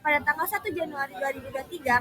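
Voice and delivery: usual speaking voice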